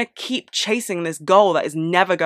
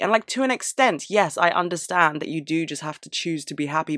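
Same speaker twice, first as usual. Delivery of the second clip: ordinary delivery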